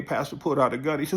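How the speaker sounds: usual speaking voice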